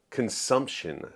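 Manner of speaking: ordinary talk, nothing marked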